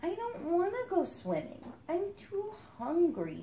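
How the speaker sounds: a whiny hungry duckling. Acted. Duckling refuses.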